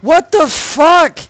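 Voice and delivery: slow, high, loud shocked yell. Real, not acted. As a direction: drag it out in shock. Startled by monster in game.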